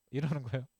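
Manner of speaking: normal talking voice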